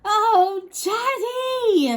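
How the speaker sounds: high, loud cry of dismay. Acted. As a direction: wail at your embarrassing dad. Daughter dismayed by father's boast.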